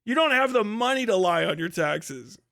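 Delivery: ordinary talk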